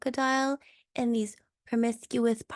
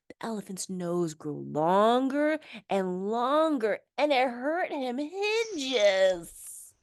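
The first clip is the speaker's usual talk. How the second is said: plain reading voice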